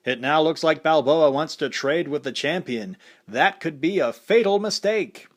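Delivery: boxing announcer calling the action; fight commentator. A narrator's reading.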